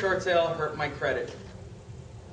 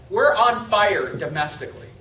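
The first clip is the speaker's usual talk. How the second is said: normal talking voice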